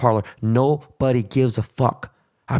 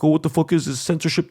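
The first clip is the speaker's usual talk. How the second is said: a lightly put-on whiny protester voice, higher; mock the complainers with a slight higher whine; mocking whiner; 4 semitones higher, faster than usual. Mocking Trump supporters who complain about censorship, lightly put-on whine.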